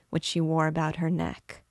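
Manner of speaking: usual speaking voice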